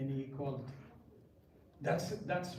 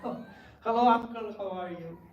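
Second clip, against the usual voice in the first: lightly put-on Indian English accent, higher; a boy greeting an uncle in an Indian accent; a touch of Indian accent for the aunties; 5 semitones higher, 4 dB louder than usual. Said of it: "talk to them in an Indian accent".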